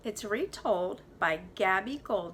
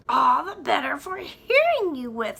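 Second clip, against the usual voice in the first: louder wolf in disguise; sly wolf reply; 6 semitones higher, 10 dB louder than usual. Acted. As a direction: answer with a toothy grin. Wolf answers the girl.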